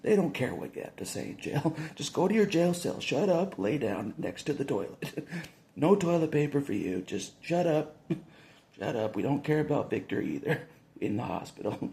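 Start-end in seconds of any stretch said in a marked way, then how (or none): 2.0-10.2 mock guard orders. Imitating jail guards telling inmates to shut up, barked. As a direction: bark like a jailer.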